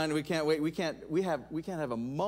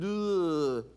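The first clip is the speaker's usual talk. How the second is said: groan. Retold or imitated. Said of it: "I'm reading it and going".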